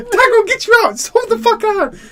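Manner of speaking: high, loud, exasperated shout; frantic yelling. Retold or imitated. Owner retelling yelling at his crying cat, high frantic shout. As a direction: holler at a pet in a panic.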